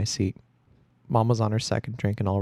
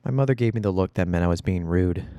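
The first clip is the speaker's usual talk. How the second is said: ordinary delivery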